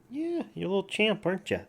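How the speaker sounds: high cooing praise. Real, not acted. Owner praising his cat, high cooing baby talk. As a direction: coo up high to the little champ.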